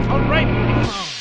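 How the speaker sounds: loud hail. Acted. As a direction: call out to the clone in triumph. Rick hails clone's finished work.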